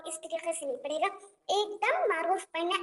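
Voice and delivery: ordinary delivery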